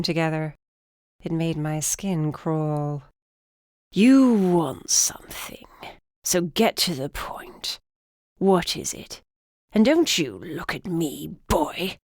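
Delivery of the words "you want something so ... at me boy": put-on slow, whispery old man snapping. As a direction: bark it like a grumpy old man. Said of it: "he snapped".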